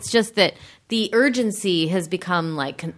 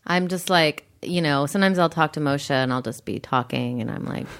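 usual speaking voice

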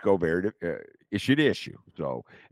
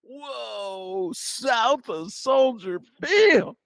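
much higher, very slow hammed-up whoa; mock amazement; much slower than usual. [name] mocking North Siders' shock, high drawn-out mock amazement. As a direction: gasp it out like your mind is blown.

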